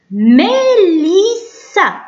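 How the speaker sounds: ميليسا very slow, high, syllables held long. Shown as a demonstration. Teacher reading a name for young pupils, syllable by syllable. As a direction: stretch out all three syllables of ميليسا. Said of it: "حطينا فوقو الشدة".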